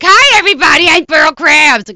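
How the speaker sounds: Pearl Krabs voice. Shown as a demonstration. Actress demonstrating her Pearl voice, high and loud. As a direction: belt it out as Pearl, slow and big. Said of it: "I can't do this".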